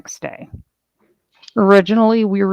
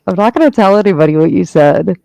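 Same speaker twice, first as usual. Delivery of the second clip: louder, teasing reassurance; mock reassuring tease; 6 dB louder than usual. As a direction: reassure them with a sly grin. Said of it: "I was like".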